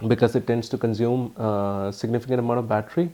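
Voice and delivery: ordinary delivery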